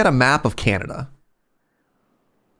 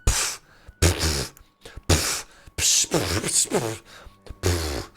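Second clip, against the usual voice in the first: spitting pff sounds; 8 semitones lower, 7 dB quieter, much slower than usual. Real, not acted. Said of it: "Stop spitting everywhere".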